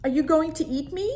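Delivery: he suddenly realises he might be dinner; sudden fear. Acted. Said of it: "But monsters eat boys".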